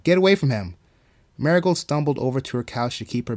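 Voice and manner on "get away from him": loud, raised shout. A narrator's reading. Marigold clawing her stomach, shouting at the voice. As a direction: drive the attacker off. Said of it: "Marigold shouted, clawing her stomach".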